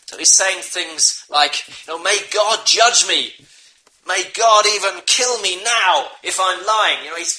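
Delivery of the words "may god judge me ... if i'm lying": Peter cursing himself. Retold or imitated. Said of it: "he began to call down curses on himself".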